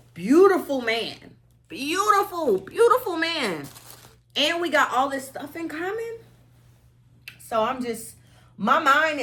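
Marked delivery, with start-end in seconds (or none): 0.1-6.2 higher, swooning gush over a beautiful man. Real, not acted. Telling viewers about her date, swooning gush. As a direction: savour each beautiful like a crush.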